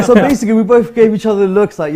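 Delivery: loud laughing telling. Real, not acted. Telling happy story, laughing.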